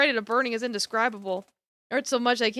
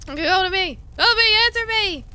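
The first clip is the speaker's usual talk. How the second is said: desperate shout; 8 semitones higher, 4 dB louder than usual. Acted. Shouting for friend amid fire.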